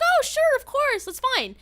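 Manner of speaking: high, sweet agreeable voice; high-pitched friendly retelling; obliging reply. Retold or imitated.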